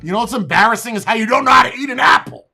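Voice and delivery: fast, loud, mocking comeback; angry shout. Real, not acted. Streamer yelling back at clip of guy about apples.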